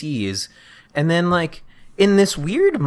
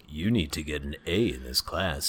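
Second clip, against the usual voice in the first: deep stern voice; 13 semitones lower, 14 dB quieter than usual. Retold or imitated. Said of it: "he's like".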